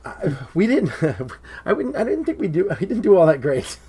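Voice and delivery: usual speaking voice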